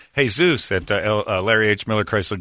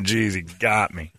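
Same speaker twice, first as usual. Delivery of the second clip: normal talking voice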